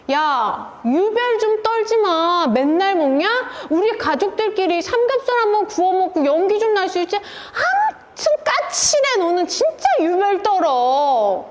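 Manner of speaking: hammed-up, high-pitched exasperated nagging; imagined scolding. Husband as wife imagined him, scolding her for being fussy. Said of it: "이렇게 들렸어요".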